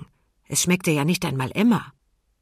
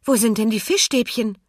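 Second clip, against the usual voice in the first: raised, puzzled call; 6 semitones higher, 4 dB louder than usual. A narrator's reading. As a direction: call out the question. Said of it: "rief Emma".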